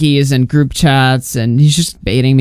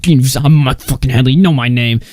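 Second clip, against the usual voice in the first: mock tough guy; 2 semitones lower than usual. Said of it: "a grown-ass man being like".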